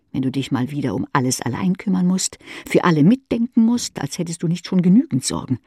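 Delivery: plain reading voice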